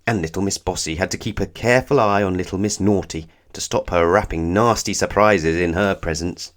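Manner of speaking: plain reading voice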